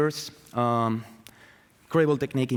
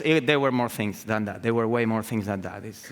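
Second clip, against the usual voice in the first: ordinary talk, nothing marked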